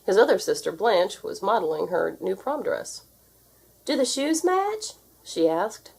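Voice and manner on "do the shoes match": put-on girl's voice. A narrator's reading. Girl voiced in story.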